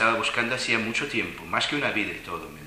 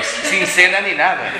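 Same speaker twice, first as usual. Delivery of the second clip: slow, louder, laughing punchline; laughing joke; 8 semitones higher, 9 dB louder, slower than usual. Retold or imitated. Joking to friends about skipping dinner, laughing slowly.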